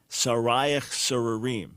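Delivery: "Sarayach sorarim" slow, leaning on each "sar"; alliteration shown. Shown as a demonstration. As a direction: draw out "sar" in both words. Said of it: "You hear that the sar".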